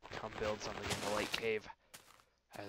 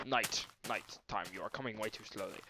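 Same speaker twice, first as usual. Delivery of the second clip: usual speaking voice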